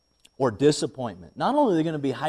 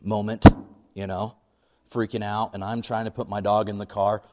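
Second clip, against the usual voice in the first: normal talking voice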